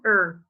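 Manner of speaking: er with the R sounded; American er, R fully voiced; American er ending. Shown as a demonstration. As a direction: show the R-coloured er sound. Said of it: "And in American".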